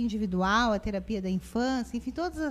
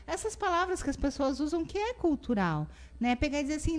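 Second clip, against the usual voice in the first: ordinary talk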